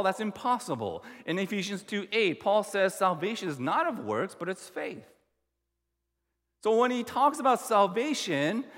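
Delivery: normal talking voice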